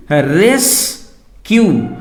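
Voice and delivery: रेस्क्यू as RES, pause, KYU, very slowly; split syllables. Shown as a demonstration. Teacher giving an example of two syllables, रेस्क्यू split slowly. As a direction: pull रेस्क्यू apart into two separate beats. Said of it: "डाइसिलेबिक का मतलब होता है दो syllable".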